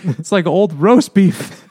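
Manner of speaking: hammed-up revulsion; mock disgust. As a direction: drag it out, grossed out. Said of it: "he's like".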